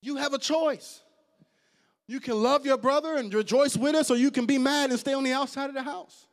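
preacher urging the congregation to rejoice; full-force preaching. Acted. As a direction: lift it up and drive it home.

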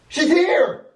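sudden high-pitched call. Acted. Announcing that she has arrived, calling out. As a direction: sing out the alert up high.

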